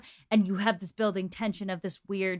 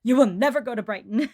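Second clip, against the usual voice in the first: a louder stern father laying down the law; mock stern father, forbidding; stern father decree; 6 dB louder, faster than usual. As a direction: boom it like a strict dad forbidding her. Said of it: "Daddy Bennett raining down".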